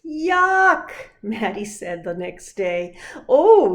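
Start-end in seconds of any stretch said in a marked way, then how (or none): 0.0-0.9 loud high yuck; child's loud yuck; disgusted. Acted. Girl smells fish in her backpack. 3.3-3.7 very loud high oh; a loud groan of disgust; child's loud oh. Acted. Girl smells the fish too.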